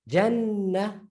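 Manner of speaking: Jannah with a long, held N; doubled N. Shown as a demonstration. Teacher pointing out the difference in Jannah, doubled N. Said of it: "Look at the difference".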